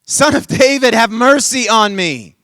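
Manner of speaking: loud, high pleading shout; big drawn-out shout for mercy. Acted. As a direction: cry out to be heard over a crowd. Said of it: "as soon as I'm done shouting".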